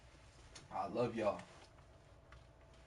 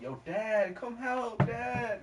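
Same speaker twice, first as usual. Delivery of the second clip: higher, mock whiny cry for dad; mock little kid calling dad, higher; 10 semitones higher, much slower than usual. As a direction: imitate the kid whining for his dad. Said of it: "that's how I interpreted it".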